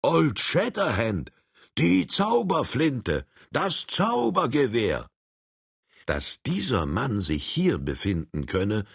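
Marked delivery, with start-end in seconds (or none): 0.0-5.1 slow, high alarmed calls; shouting. A narrator's reading. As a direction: call out his name in alarm. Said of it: "man hörte sie rufen".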